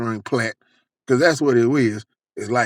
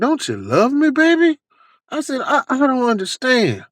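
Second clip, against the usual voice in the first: high-pitched, hammed-up hurt; mock heartbroken whine; retold wounded plea; 10 semitones higher than usual. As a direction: plead like a jilted lover, pitched up. Mock crying plea to dealer.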